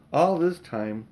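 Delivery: ordinary delivery, nothing marked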